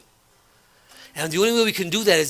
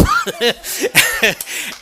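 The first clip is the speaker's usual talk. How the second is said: high burst of real laughter; big laugh; 4 dB louder than usual. Real, not acted. Recalling teasing a coworker, a real high laugh. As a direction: laugh out loud at your own joke.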